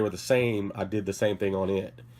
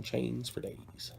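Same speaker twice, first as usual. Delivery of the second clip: slow, much softer repeat; quiet echo; 14 dB quieter, much slower than usual. Real, not acted. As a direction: murmur it again to yourself. Repeats it to himself.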